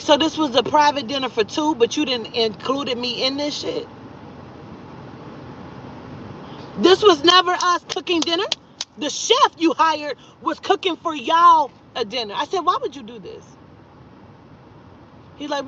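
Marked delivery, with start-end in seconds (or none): 0.0-12.3 higher-pitched retold protest; indignant confrontation. Retold confrontation over private dinner. 12.6-13.4 softer, slightly higher retold question; restrained reproach. First asking in retold confrontation.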